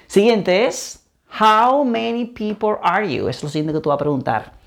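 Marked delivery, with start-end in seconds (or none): 1.4-3.3 "How many people are you" slowly, each word apart; slow, careful question for learners; slow model. Shown as a demonstration. Slow model before the quick take.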